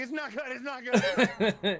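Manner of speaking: slow, high and loud, laughing in panic; panicked repeat. Retold or imitated. Patient repeating the doctor's bad news, high laughing panic. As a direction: echo the doctor's words in horrified disbelief. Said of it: "And I was like".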